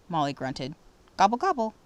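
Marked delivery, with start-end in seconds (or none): 1.2-1.7 higher, louder mock turkey gobble. A narrator's reading. Girl mocking a rival as a turkey, higher gobble. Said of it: "Mona imitated a turkey".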